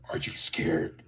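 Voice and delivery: low, whispered creepy stranger; creepy reptilian voice. Retold or imitated. Reptilian man asks the narrator on the bus, low whisper. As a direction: hiss it low like a lizard man. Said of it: "I remember his voice. He was like".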